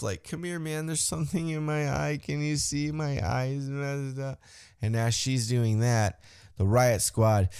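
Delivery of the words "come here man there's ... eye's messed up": softer, higher mock whine; lightly voiced faking wrestler; fake eye injury plea. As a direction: pretend to be hurt to the ref. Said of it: "plays off an eye injury".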